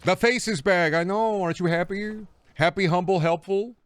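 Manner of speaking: put-on high, loud baby talk; high mock-sweet praise; high cooing voice. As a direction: coo at it like a proud owner. Cooing at game character.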